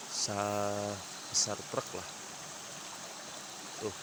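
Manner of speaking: ordinary delivery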